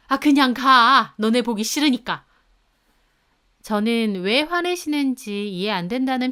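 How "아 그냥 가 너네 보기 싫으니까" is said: mother-in-law loudly sending the couple away; angry dismissal. A narrator's reading. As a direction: drive them out of the house with it. Mother throws the family out.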